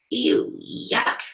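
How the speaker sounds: yucked-out little aside; disgusted. A narrator's reading. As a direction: recoil from icky food. Story voices the girl's disgust.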